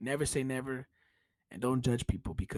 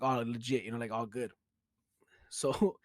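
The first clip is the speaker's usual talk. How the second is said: usual speaking voice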